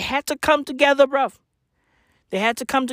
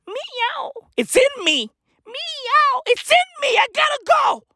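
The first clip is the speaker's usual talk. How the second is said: much higher, meowing like a cat, building to a shout; catlike meowing. Acted. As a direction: go feral, full cat. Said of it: "Catwoman needs to look at Bruce Wayne and say".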